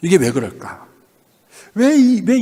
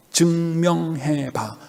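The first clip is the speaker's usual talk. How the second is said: very slow and softer; weighty charge; 2 semitones lower, 5 dB quieter, much slower than usual. Acted. Jesus charging disciples to prove it, slow and softened. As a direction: stretch each syllable, hushed and deliberate.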